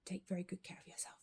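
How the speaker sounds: soft breathy whisper, no voice. Real, not acted. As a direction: whisper a tender goodbye. Repeats the farewell, whispered.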